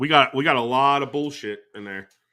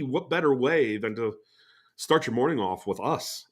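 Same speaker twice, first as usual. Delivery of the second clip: ordinary delivery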